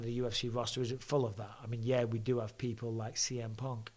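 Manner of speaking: normal talking voice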